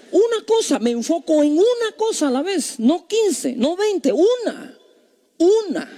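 high-pitched, insistent preaching; high, punchy emphasis on every phrase; emphatic insistence. Real, not acted. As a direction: drive it home, pitched high. Preacher hammers home one thing.

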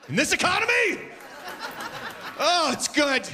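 very high, laughing gasp; mock amazement. Comic marvelling at the cheap Costco hot dog, high squeal. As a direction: squeal it like it's a steal.

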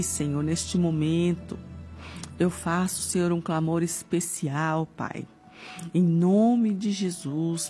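ordinary talk, nothing marked

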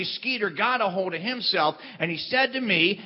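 normal talking voice

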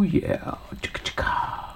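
breathy whispered beatbox chant. Pretend. Host whispering a playful beat to co-host, whispered. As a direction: whisper it like a groove.

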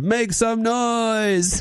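drawn-out DJ call; slow, high hype-man call. Retold or imitated. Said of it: "he screamed when the D J said".

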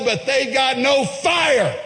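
slow, high shouted punchline; emphatic shout. Acted. Climax of failed Baal ritual.

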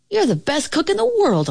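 high, quick eager dog voice; barking dog. A narrator's reading. Dog to the dejected cook, praising him, eager bark. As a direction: cheer him on fast and high, like a loyal dog. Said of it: "I barked".

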